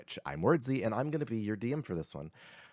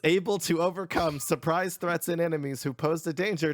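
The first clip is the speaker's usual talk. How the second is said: loud, raised-pitch recap read; shouted narration, high and loud; accidental shouting; 10 semitones higher, 7 dB louder than usual. Real, not acted. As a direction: read it out as if all in caps. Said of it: "Why are you shouting".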